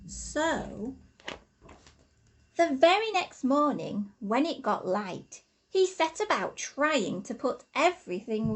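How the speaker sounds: lively, rhythmic storybook reading; reading to children. Acted. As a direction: read the rhymes aloud to little ones, bouncing along. Reads a rhyming picture book aloud.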